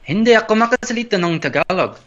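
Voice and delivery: high-pitched Filipino lilt; Filipino accent. Shown as a demonstration. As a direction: keep the Filipino accent going. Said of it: "get into the accent".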